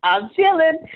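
loud, high, smug and lazy; smug coasting voice. Pretend. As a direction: brag like you've got it made. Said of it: "when you're comfortable and I'm chilling".

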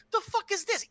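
very high, baffled question; incredulous. Retold or imitated. Co-host imagines waking up to that, incredulous. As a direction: demand an explanation in a high voice.

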